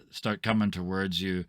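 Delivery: ordinary delivery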